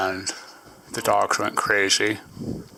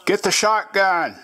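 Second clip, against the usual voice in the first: high-pitched call to the wife; acted yell; 10 semitones higher than usual. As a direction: yell it like a man alerting his wife. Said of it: "yells at the wife".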